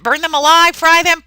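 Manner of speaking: teacher loudly acting out the mob's cry; mob's yell. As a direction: yell it like the mob did. Loudly re-quoting the mob.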